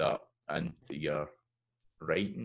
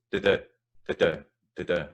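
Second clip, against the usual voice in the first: da-da snapped short-long, louder; Scots snap rhythm; 6 dB louder, faster than usual. Shown as a demonstration. Teacher demonstrating the Scots snap. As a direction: snap each da-da. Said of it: "which is the Scots snap rhythm".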